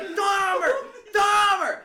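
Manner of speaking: high, loud shout of a name. Retold or imitated. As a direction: shout the name twice at full force. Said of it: "He's like".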